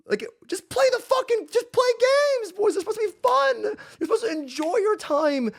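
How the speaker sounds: high, pleading exasperation; almost yelling it at them in disbelief; high exasperated rant at chat. Real, not acted. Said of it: "exasperated plea to chat".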